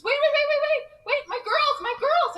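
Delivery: very high, loud frantic shouting; panicked calling out. Retold or imitated. Mom chasing the bus with a stroller, panicked. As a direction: cry out desperately, words tumbling over each other. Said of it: "panics and says".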